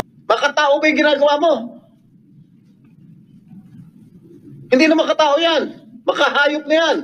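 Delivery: high, sustained indignant tirade. Real, not acted. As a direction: berate the witness with outrage. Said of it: "raising my voice". (galit na panunumbat, mataas ang boses)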